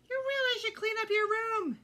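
high, loud scolding mother; mother scolding her son about his messy room; nagging mother. Acted. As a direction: nag him like an exasperated mom. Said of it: "his mother said".

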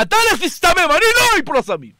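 high, scornful defiance; defiant retort. Acted. Preacher voicing a listener rebuking his evil urge, defiant. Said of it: "תגיד ליצר הרע".

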